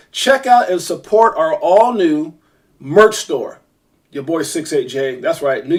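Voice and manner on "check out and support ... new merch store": slow, higher announcer-style plug; hyped promo plug. Real, not acted. Host sending viewers to the merch store, hyped promo. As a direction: draw out each phrase like an ad read.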